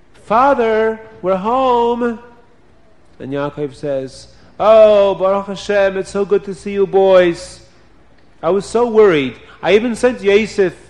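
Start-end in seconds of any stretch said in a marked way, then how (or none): 0.1-2.2 cheerful call. Acted. Brothers call out arriving home. 4.6-10.8 put-on raised pitch, relieved old father. Yaakov greets his sons.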